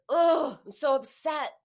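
loud, high anguished cry of upset; feeling it out loud. Shown as a demonstration. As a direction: really let the upset burst out. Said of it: "Feeling it is".

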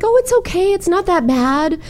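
high-pitched mock soothing of a child; minimizing reassurance. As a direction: brush the hurt away in a high soothing voice. Soothing crying child, retold.

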